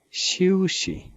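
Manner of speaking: शीउशी pitched high and carefully toned; tone demo. Shown as a demonstration. Teacher repeating शीउशी for learners, high careful tones. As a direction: lift शीउशी up and shape both tones. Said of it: "x I यू is शिव".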